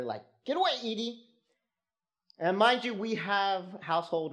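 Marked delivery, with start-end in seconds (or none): 0.5-1.3 high, sharp command at the dog. Retold or imitated. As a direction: bark it at the begging dog. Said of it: "barking at her".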